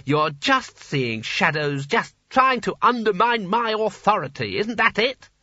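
indignant, rising to high pitch; angry accusation. Acted. Sconner accuses wizard of undermining his authority, indignant. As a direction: start drawn out, build to a high accusation.

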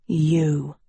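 restrained angry hiss; low-key hostile accusation. A narrator's reading. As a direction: hiss it out with contempt. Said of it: "Tedros hissed".

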